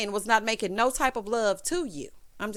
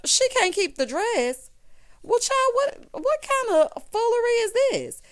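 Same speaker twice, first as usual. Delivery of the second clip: shocked disbelief; 14 semitones higher, 6 dB louder than usual. Retold or imitated. Recapper's shocked inner reaction.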